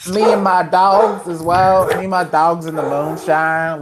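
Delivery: slow mock hillbilly drawl; hammed-up Southern American voice; bad Southern accent. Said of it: "that's a pretty bad Southern accent".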